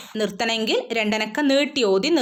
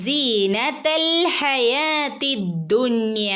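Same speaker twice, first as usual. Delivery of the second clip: slow, chanted verse reading; Quran recitation; 3 semitones higher, much slower than usual. Shown as a demonstration. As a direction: recite it slowly like in class. (പതുക്കെ ഖുർആൻ പാരായണം)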